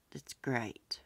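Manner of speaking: whispered to herself; soft whisper. Real, not acted. Quietly awed by the fundraiser total, whispering. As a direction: breathe it out as a whisper, barely voiced.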